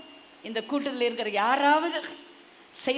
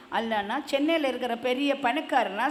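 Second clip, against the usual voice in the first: ordinary talk, nothing marked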